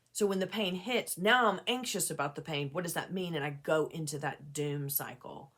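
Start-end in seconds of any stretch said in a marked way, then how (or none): none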